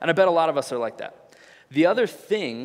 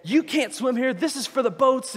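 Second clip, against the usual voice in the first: high, pushed mock yell; retold screaming; 9 semitones higher, 4 dB louder than usual. Boater screaming at swimmers, retold as a mock yell. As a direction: imitate an irate man scolding from his boat. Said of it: "just screaming at people".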